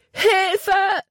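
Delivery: a high cry for help as she falls; desperate scream for help; loud scream. A narrator's reading. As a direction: scream it out in terror. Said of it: "und schrie laut".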